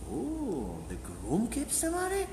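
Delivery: a high, hushed, incredulous "the groom keeps the money?"; stunned disbelief. Retold or imitated. The bride's father, stunned by the Arizal's ruling, asks in hushed disbelief. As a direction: let the shock drain his voice to a high murmur.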